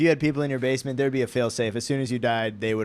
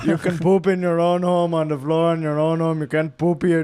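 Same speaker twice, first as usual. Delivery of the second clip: louder put-on lemur-king accent; King Julian accent; 4 dB louder than usual. Club staffer forbidding poop, in a King Julian accent. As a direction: lay down the rule in a cartoon Indian-style accent. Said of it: "This is King Julian".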